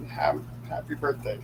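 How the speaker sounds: normal talking voice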